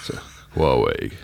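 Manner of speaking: very low, slow crooner voice. Pretend. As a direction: drop right down and draw it out like a late-night soul singer.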